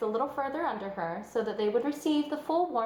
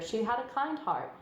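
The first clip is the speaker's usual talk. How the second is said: usual speaking voice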